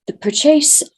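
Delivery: ordinary delivery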